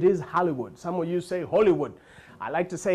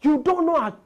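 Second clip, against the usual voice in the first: put-on high, louder Nigerian-accented scolding; mock Nigerian indignation; 8 semitones higher, 3 dB louder than usual. Nigerian guest scolding the clerk, high accented indignation. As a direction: scold him for not knowing English.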